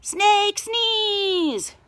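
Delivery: high, loud chanted cheer; crowd shouting. A narrator's reading. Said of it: "shouted the crowd".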